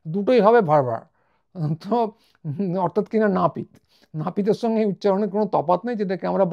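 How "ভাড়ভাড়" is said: ভাড়ভাড় with both words merged into one English-style sound; foreign mispronunciation. Retold or imitated. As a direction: mimic foreigners flattening the word to ভাড়ভাড়. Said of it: "ওরা আলাদা উচ্চারণ করতে পারে না".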